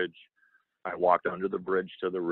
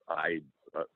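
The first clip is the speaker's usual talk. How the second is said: ordinary talk, nothing marked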